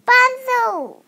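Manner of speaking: high, loud cry of Bonzo; shouted. Acted. Sally shouting as she spots Bonzo. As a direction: shout the name like you just spotted him. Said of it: "Sally shouted".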